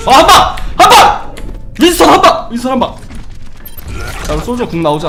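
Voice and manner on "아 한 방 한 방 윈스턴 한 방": loud, very high hype shout; excited shout. Real, not acted. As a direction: cheer the big hit. Hyped call of big play.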